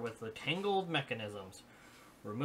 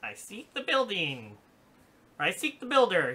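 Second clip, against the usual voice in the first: higher, louder stiff robot voice; 6 semitones higher, 7 dB louder than usual. Acted. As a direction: speak like a broken old robot. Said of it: "he says".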